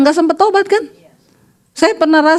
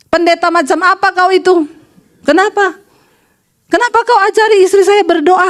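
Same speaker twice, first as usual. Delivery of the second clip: higher-pitched mock outrage; indignant husband; 5 semitones higher than usual. Husband phones the pastor about his wife's prayer, indignant. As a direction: imitate an irate husband demanding answers.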